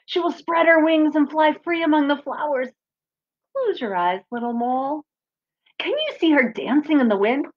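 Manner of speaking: gentle storybook reading; reading to children. Acted. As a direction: read it to the kids like a picture book. Reads picture book aloud.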